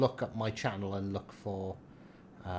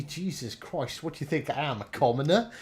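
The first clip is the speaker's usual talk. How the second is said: snooty, offended; mock posh outrage; 4 semitones higher, 7 dB louder than usual. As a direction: play the indignant toff.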